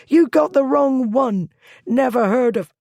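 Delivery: high, loud frantic denial; panicked denial. A narrator's reading. Thief frantically denying to Blackwood, high and loud. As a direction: deny it fast before being cut off.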